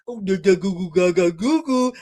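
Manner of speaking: lightly voiced babbling toddler; mock baby gibberish; baby babble. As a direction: babble like a tiny child. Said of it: "And she said".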